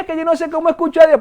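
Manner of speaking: high, rapid mock complaint; complaining believer. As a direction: voice a believer protesting in a rush. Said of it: "un hijo de Dios que me diga".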